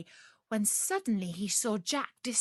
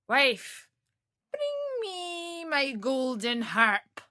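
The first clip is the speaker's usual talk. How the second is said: slow, much higher giant call; ogre command; 6 semitones higher, 3 dB louder, much slower than usual. Acted. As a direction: demand it like a lazy giant.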